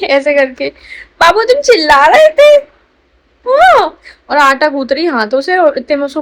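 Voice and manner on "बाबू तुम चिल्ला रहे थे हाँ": high, louder cooing question. Real, not acted. Mother cooing at crying baby.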